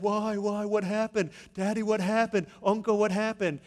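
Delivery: put-on high, loud, insistent kid questions; puzzled children. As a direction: imitate kids pestering the grown-ups. Children asking adults, acted.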